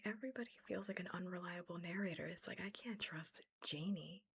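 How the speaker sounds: ordinary delivery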